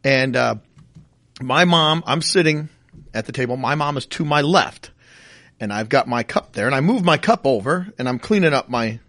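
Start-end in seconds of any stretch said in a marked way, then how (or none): none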